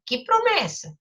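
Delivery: put-on a boy puzzled by a promise; surprised question. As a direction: ask it with sudden surprise. Character asks, surprised.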